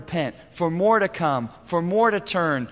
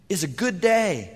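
usual speaking voice

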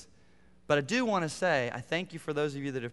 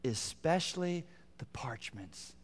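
slow hushed whisper on "Especially"; whispered emphasis; 6 dB quieter, slower than usual. Shown as a demonstration. Preacher pointing the congregation to a key phrase, whispered. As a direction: breathe "Especially the parchments" almost silently. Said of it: "here's the phrase I want you to see".

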